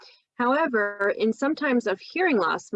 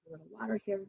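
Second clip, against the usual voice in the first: ordinary delivery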